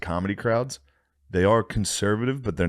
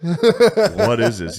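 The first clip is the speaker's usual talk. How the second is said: baffled audience; 10 semitones higher, 7 dB louder, much slower than usual. Retold or imitated. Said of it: "you can s-, watch people".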